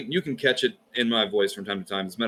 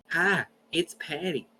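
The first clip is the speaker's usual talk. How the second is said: Southern grandmother voicemail; 5 semitones higher, 3 dB quieter than usual. Retold or imitated. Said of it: "how Southern that was".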